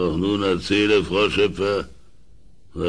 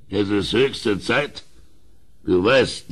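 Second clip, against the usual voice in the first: normal talking voice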